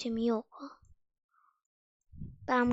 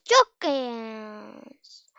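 mock reassurance; 4 semitones lower, 5 dB louder than usual. Real, not acted. Ironic exaggerated reassurance.

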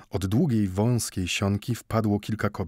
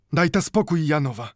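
put-on old man's voice, raised higher and louder, firm as a bell; firm ringing voice; 7 semitones higher, 4 dB louder than usual. A narrator's reading. Old Mendel firmly refusing the women's help. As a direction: stand firm, ring it out. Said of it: "mówił twardym, brzmiącym jak dzwon głosem".

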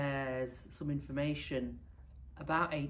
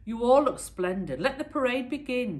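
loud, higher royal declaration; declaring; 9 semitones higher, 10 dB louder than usual. Acted. Boy emperor opening the parade, loud and high. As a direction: announce it to the whole hall. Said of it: "declared the boy emperor".

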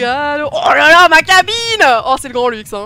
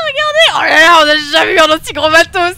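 excited shout; 3 semitones higher, 10 dB louder, faster than usual. Acted. Amazed at the huge ship.

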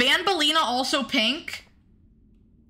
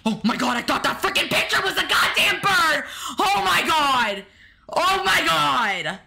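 real fright; 6 semitones higher than usual. Real, not acted. Streamer spooked by a picture she took for a bird, high frightened cries. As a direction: burst out scared, then stretch it.

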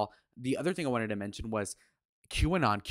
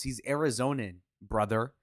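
ordinary delivery

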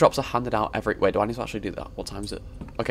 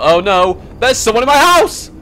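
loud, high hammed-up alarm; exaggerated squealing panic; mock panic; 13 semitones higher, 9 dB louder than usual. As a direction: shout in pretend horror. Mock panic expecting jump scare.

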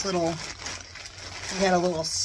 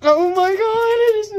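high, louder squeal of delight; excited squeal; 12 semitones higher, 7 dB louder than usual. Real, not acted. Seeing the skull bag, a loud high squeal. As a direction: squeal at the sight of it.

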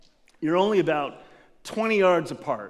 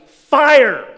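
loud, higher "Fire!"; shouted command; 7 semitones higher, 13 dB louder than usual. Retold or imitated. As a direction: yell the order across the field. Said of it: "and the guy goes".

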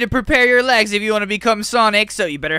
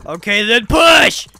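shouting; 4 semitones higher, slower than usual. Acted. Drill sergeant yelling orders.

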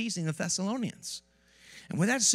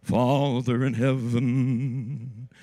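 slow, loud, quavering holy-voice prayer; churchy vibrato prayer parody; mock preacher prayer; 5 semitones lower, 6 dB louder, much slower than usual. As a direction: ham up a pompous preacher praying. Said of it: "I don't know why they put vibrato".

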